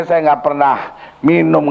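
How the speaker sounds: ordinary delivery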